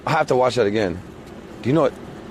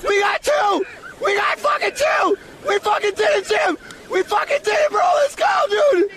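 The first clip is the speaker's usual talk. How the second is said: excited shouting; 12 semitones higher than usual. Real, not acted. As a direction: cheer it at full voice. Catching two fish, celebrating.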